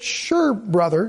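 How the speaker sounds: a drawn-out, unwilling "Sure, brother."; reluctant sure. Shown as a demonstration. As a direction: let "Sure" sag with reluctance. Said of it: "Did you notice the difference between the sures".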